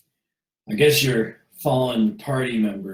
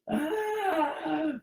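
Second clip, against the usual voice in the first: burning scream; 7 dB quieter than usual. Acted. Townsperson screams.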